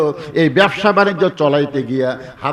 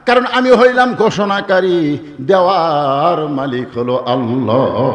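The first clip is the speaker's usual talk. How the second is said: melodic preaching; 2 semitones higher, 4 dB louder than usual. Real, not acted. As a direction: stretch the phrase ends in a slow preacher's chant. (সুর করে ওয়াজ)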